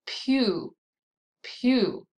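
"pew" as a separate syllable with falling tune; stressed syllable alone. Shown as a demonstration. Teacher isolating the middle syllable, separate falling "pew". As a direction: set "pew" apart as one strong syllable. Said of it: "the middle syllable".

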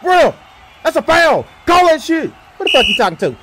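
usual speaking voice

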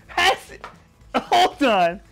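high, loud, slow, near-crying; dramatic exasperated wail; mock whining wail. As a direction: whine it in hammed-up panic. Hammed hashtag panic.

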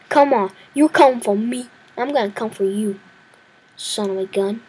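normal talking voice

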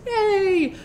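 high-pitched little cheer; a drawn-out crowd hurrah, lightly done. A narrator's reading. Winkies cheering the departing travelers, cheering.

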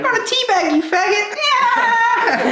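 high, loud taunting yell; gamer yell. Real, not acted. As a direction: yell the taunt at the top of your voice. Gaming taunt yelled.

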